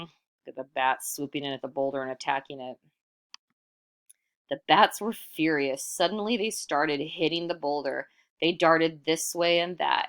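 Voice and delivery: ordinary delivery, nothing marked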